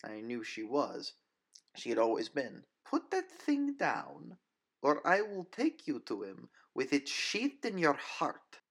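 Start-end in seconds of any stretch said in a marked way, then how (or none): 2.8-8.6 Nina's threat. Acted. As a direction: promise death calmly. Nina threatens.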